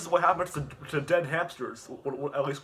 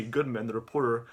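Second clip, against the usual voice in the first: ordinary talk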